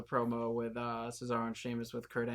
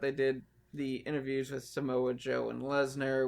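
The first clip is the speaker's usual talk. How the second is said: normal talking voice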